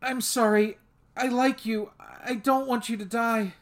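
frightened apology. A narrator's reading. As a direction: voice her rising fear as she apologizes. Sveta pleading in distress.

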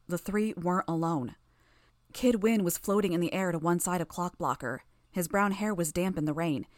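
ordinary delivery, nothing marked

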